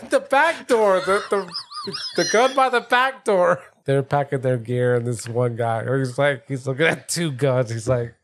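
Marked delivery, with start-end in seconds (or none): 0.1-3.6 high, slow comic yelp; hammed-up frantic correction, stretched out high; mock exasperation. Killer correcting a partner's wrong gun, mock exasperated.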